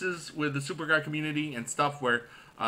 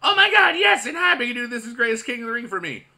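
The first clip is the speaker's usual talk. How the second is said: excited fan; 8 semitones higher, 6 dB louder than usual. Acted. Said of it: "fan five one nine says".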